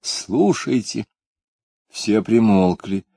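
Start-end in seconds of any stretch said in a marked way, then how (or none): 0.0-1.0 softer, slow falling call. A narrator's reading. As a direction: let the second call sink down slowly. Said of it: "крикнула в небо".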